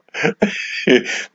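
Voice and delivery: quiet breathy laugh; real laugh after the joke; soft airy laugh. Real, not acted. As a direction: let out a soft, airy chuckle. Cracking up at chat.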